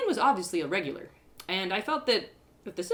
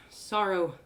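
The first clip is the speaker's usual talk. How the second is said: plain reading voice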